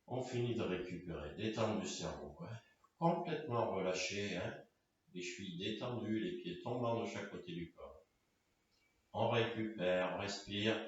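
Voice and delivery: low, soft recovery cues; calm, hushed yoga teacher; guided relaxation. Real, not acted. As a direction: talk them down softly into rest. Guides relaxation after the effort.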